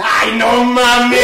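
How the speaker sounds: loud, slow, laughing shout; punchline shout. Acted. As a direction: blast the punchline and crack up. Punchline of the joke. (grito fuerte y lento del remate, riéndose)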